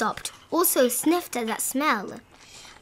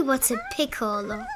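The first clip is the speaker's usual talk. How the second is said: ordinary delivery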